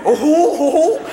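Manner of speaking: high, whooping cartoon chortle; loud rising cartoon whoops; Goofy. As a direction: hoot it like a silly cartoon dog. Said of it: "And there's Goofy".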